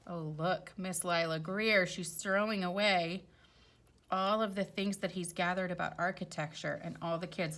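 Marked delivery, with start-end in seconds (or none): none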